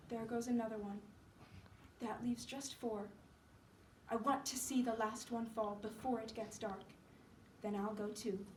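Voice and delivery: soft, listless invalid; weak Johnsy. Acted. Sick Johnsy watching leaves fall, faint and resigned. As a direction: speak faintly, with little energy left.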